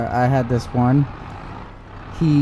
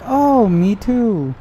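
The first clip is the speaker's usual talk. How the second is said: high, slow and drawn-out fake agreement; mock scammer voice; 9 semitones higher, 4 dB louder, slower than usual. Imitating the scammer claiming to be local, high and slow. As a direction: stretch it out, sweet and phony. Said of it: "And they're like".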